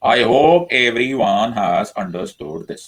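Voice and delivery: each word stretched extremely slowly; very drawn out. Real, not acted. Teacher checking students have understood, very drawn out. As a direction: draw out every word, singling each one out.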